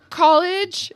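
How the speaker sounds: "College." shot out high and loud; blurted answer. Retold or imitated. Answering the border agent's question, a nervous loud blurt. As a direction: blurt "College." too quickly and too loudly. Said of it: "And this would be my answer".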